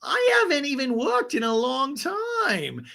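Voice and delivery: sing-song it high, drag the confession out; high, breathless Gina. Retold or imitated. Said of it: "Gina lets us know".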